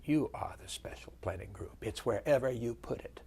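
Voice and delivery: a general's soft Virginia whisper, dryly amused; hushed Virginia accent. Retold or imitated. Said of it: "he had that soft Virginia accent".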